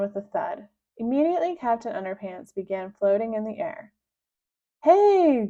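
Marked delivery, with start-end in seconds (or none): 4.8-5.5 high, loud joyful cry; a hero thrilled to have powers back. Acted. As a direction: burst out with delight. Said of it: "cried the good captain".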